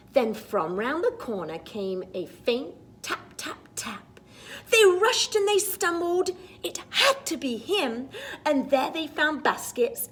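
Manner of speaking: put-on lively read-aloud for children; storybook reading. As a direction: read it like a bedtime picture book. Reads the picture book rhyme.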